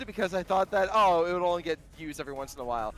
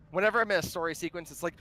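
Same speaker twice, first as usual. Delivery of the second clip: normal talking voice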